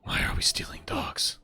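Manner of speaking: whispered disbelief; baffled whisper. Retold or imitated. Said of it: "I was like".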